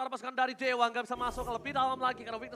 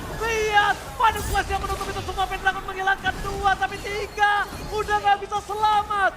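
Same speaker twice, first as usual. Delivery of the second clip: excited shouting; 6 semitones higher, 5 dB louder than usual. Real, not acted. Caster hype at teamfight.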